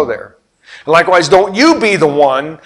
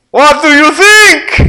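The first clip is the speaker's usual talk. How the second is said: high, loud, slow showman call to the crowd; 13 semitones higher, 9 dB louder, slower than usual. Retold or imitated. Blondin calls to crowd.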